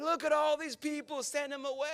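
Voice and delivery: high, flustered plea to send them off; flustered disciples. Retold or imitated. Preacher voicing disciples to Jesus, high and flustered. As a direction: panic at the size of the crowd. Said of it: "And they're like".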